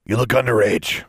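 gruff, suspicious shop owner; gruff proprietor. Acted. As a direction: challenge the kid like a gruff shopkeeper. Gruff proprietor challenges young visitor.

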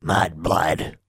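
lightly growled creature insult; sluggish growl. A narrator's reading. Creature insults a girl in her hands, sluggish growl. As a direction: growl it sluggishly, without much force. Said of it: "growled Honey sluggishly".

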